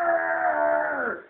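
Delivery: long high howling roar; monster howl. Acted. Wild things howling at the moon, long high roar. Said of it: "they're all howling at the moon".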